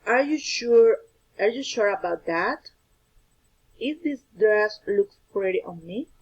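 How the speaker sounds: yes-no questions with "that" and "me" lifted in pitch at the end; rising intonation. Shown as a demonstration. Teacher giving examples of yes-no questions, rising intonation on "that" and "me". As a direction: let the voice climb on the last word, "that", then "me". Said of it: "Yes, no questions use rising intonation".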